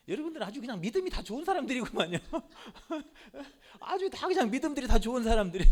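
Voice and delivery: 웃음 섞인 빈정거림; 킥킥 웃으며 청중을 놀리듯. Real, not acted. (청중의 반응에 웃음 터진 설교자, 웃으며)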